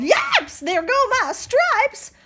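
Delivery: high yelling zebra; zebra yells. Acted. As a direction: cry out in dismay. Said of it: "The zebra yelled".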